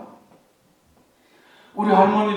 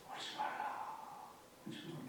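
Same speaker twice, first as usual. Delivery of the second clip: hushed comfort; 24 dB quieter than usual. Acted. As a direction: hush a grieving mother. Quoting Jesus to the widow.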